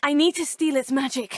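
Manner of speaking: usual speaking voice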